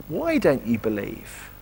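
low, soft, slow reproach; a bit exasperated. Retold or imitated. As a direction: ask it slowly and quietly, with understated exasperation. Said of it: "Jesus is a bit exasperated with us".

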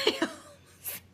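high, slow, laughing. Real, not acted. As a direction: giggle it out, still cracking up. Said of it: "I almost snorted".

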